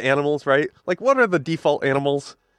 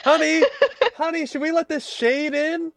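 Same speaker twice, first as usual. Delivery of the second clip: higher, giggly suburban wife; high homeowner voice; 11 semitones higher than usual. Pretend. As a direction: ask your spouse sweetly, half laughing. Voices homeowner talking to spouse.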